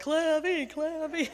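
put-on high, playful kid's nickname call. Camper playing up his nickname, playful kid voice. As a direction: imitate a kid chanting his own nickname. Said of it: "So he'd be like".

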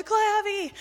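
high, loud group chant imitated; cheering kids. All the campers echo his nickname, cheering kids. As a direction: call it out like a crowd of kids. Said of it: "and all the kids".

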